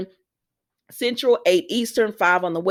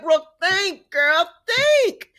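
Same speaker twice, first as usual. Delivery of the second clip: exasperated urging; 7 semitones higher, much slower than usual. Retold or imitated. Acts urging Brooke to work it out.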